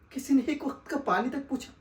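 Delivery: ordinary talk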